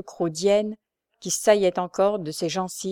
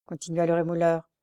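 plain reading voice

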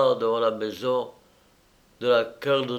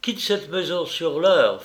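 higher-pitched indignant scorn; angry outburst; 5 semitones higher than usual. A narrator's reading. Mistress scorning his money and ordering him out, carried away. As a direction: dismiss him with rising vehemence. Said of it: "fit-elle avec emportement".

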